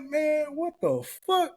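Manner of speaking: very high, drawn-out disbelief; mock dismay. Young man looking at his girl, hammed-up dismay. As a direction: stretch it out in a high comic whine.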